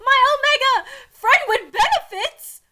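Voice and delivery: high, loud mock yell. A narrator's reading. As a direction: shout it out in alarm. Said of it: "They both yell".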